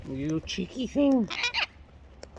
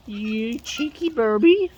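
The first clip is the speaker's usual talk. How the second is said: slow, drawn-out playful scold; drawn-out teasing; 5 semitones higher than usual. Real, not acted. Scolding a cheeky bird in slow playful tease.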